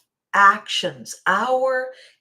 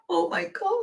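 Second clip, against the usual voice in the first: higher, thrilled oh my God; delighted exclaim; 11 semitones higher than usual. Retold or imitated. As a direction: sound delighted by the show. Audience reacting to the show.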